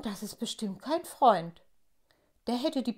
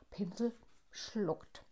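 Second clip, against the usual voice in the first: ordinary delivery, nothing marked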